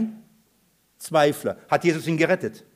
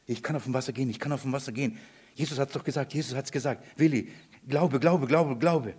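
low-key, quiet muttering to oneself; hushed self-persuasion; 3 semitones lower, 7 dB quieter, faster than usual. Acted. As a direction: psych yourself up under your breath, repeating it. Petrus redet sich Glauben ein. (leise sich selbst Mut zureden)

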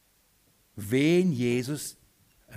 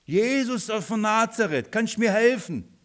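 crying out for help; 7 semitones higher than usual. Acted. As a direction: call out for help from afar, pitched up. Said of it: "was schreit er?". (um Hilfe rufend)

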